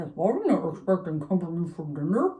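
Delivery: mumbling through a mouthful of meatballs; surprised neighbour talking with her mouth full. Shown as a demonstration. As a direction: say it round a big bite of food. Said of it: "Probably sounded something more like this".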